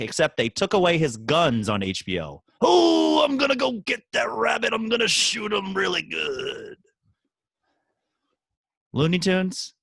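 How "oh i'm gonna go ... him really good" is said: Yosemite Sam impression. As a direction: ham up a trigger-happy cartoon varmint hunter. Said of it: "a cartoon character that has made a comeback".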